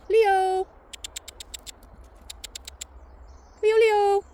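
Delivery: loud high call to the dog. Real, not acted. Calling dog.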